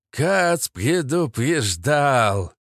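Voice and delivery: slow, drawn-out sinister warning; measured villain menace, slow and theatrical; ominous. A narrator's reading. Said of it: "зловеще произнёс".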